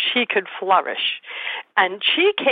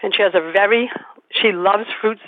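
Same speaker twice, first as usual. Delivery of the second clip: ordinary talk, nothing marked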